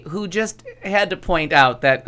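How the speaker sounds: ordinary talk, nothing marked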